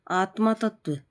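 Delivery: ordinary delivery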